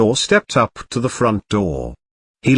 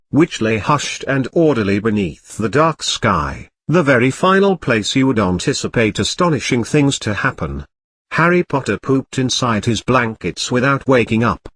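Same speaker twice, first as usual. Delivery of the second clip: ordinary delivery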